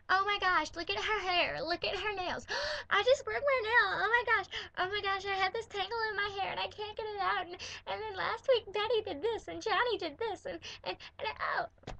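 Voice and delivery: put-on high, breathless gossipy girly-girl voice with a gasp; mock a dramatic girly-girl fussing over nails; mocking frilly girl. Teen mocking the frilly girls at school in a high gossipy voice. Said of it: "all these frilly girls like".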